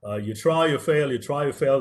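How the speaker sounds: ordinary talk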